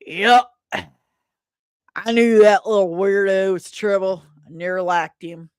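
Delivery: loud, scornful small-town neighbor; Bob the neighbor. Acted. Neighbor sneers at the missing bookworm, loud gruff voice. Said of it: "Bob, off panel".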